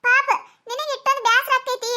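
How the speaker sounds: usual speaking voice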